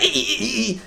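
high, short repeated disc-drive squeaks; imitated disc squeak. Gamer showing viewers how the disc sounded, in high squeaks. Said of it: "the disk would be like".

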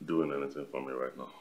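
low, soft, half-whispered muttering. Acted. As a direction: mutter it to yourself. Drink not strong, low unvoiced.

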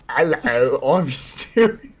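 loud, drawn-out British accent, hammed up; Stewie. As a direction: exaggerate a bad British cartoon-baby voice, loud. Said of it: "worst British accent I've ever heard".

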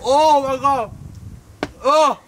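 loud, high cry of pain; pained shout. Real, not acted. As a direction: cry out as it burns. Mouth burning from hot sauce.

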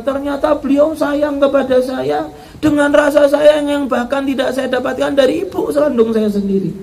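put-on high, earnest young woman insisting; earnest daughter-in-law. Daughter-in-law insists to the Kiai she wants her mother-in-law alive, high and earnest. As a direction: insist with all your heart in a high voice.

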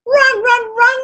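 high-pitched chanting cry; storyteller's excited crowd yell. A narrator's reading. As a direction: chant it like fans at a race. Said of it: "they yelled again".

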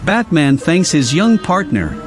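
plain reading voice

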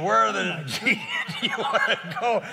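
hammed-up driver's outburst, laughing through it; imitated cussing driver. Out-of-control taxi driver demanding a destination, imitated cussing. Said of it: "he's cussing and".

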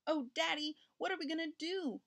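put-on high, mild childlike wail. A narrator's reading. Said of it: "wailed Richard".

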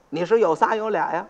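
normal talking voice

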